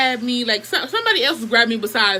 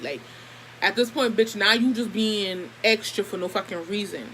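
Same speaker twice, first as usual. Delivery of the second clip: ordinary talk, nothing marked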